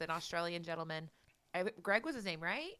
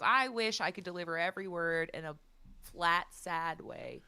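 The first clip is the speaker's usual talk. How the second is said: very slow, flat sad drawl; mock flat sad delivery; 2 semitones lower, 3 dB quieter, slower than usual. Imagining the Brit envying the flat American tone, slow sad delivery. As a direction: drag the words out flat and sad. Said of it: "wakes up and goes".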